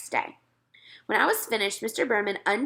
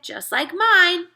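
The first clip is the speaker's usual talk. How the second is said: put-on humouring toddler; 10 semitones higher, 7 dB louder, much slower than usual. A narrator's reading. Peter humours brother.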